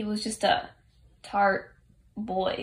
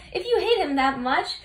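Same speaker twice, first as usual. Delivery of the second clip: principal; 5 semitones higher, 3 dB louder than usual. Retold or imitated. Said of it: "the procrastinating principal appears and says".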